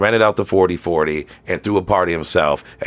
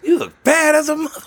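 high falsetto lyric. Pretend. Lyric continues.

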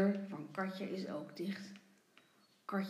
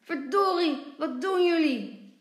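loud, raised-pitch scolding; angry father shouting; 7 semitones higher, 9 dB louder than usual. A narrator's reading. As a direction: demand an answer from naughty children. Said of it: "En vader roept".

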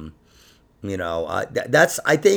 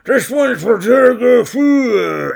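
slow, louder, mumbled singer dedication; slurred singer imitation; 11 semitones higher, 7 dB louder, slower than usual. Imitating the singer dedicating a song onstage, slurred and slow. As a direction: slur it like a drunk singer on stage. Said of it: "He was like".

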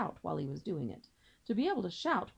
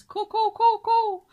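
high, loud hooting 'Ko, ko'; owl hoot; 13 semitones higher, 13 dB louder, slower than usual. Acted. Father swan imitating the owl for his children, high loud hoot. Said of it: "the little owl that says".

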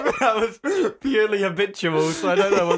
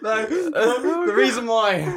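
laughing; 8 semitones higher than usual. Real, not acted. Laughing at choked friend.

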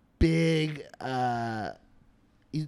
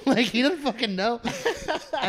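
ordinary delivery

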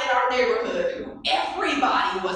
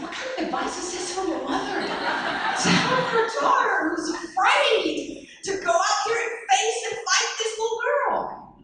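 much higher, laughing incredulity; laughing disbelief; 12 semitones higher than usual. Real, not acted. Marveling at her mother's advice, laughing high disbelief.

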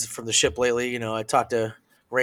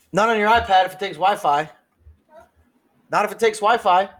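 high, louder mock voice; high-pitched mimicking delivery; put-on catchphrase; 8 semitones higher, 3 dB louder than usual. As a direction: toss out the line in a raised put-on voice. Put-on catchphrase repeated after banter.

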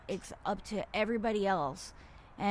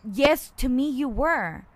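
louder, slow, retold comeback; firm retort; 3 semitones higher, 10 dB louder than usual. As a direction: turn back and fire the reply at her, slowly. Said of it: "I turned back and I said".

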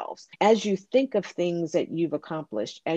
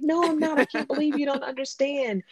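indignant tantrum; 9 semitones higher than usual. Retold or imitated. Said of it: "And I'm like".

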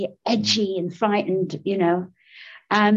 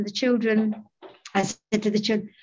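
normal talking voice

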